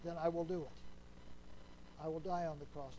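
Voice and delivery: soft, very slow resolve; quiet surrender. Acted. Jesus telling the Father he will die, quiet and slow. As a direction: let each word fall slowly and low.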